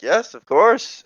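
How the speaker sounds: high, slow chirpy agreement; mock cheerful. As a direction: chirp it sweetly and slowly. Character answers in high voice.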